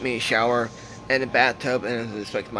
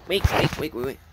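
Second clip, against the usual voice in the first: imitated quick "wheek wheek" squeals; guinea pig squeaks; faster than usual. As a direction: mimic a guinea pig's little squeaks. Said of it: "Sounds like breaking a guinea pig".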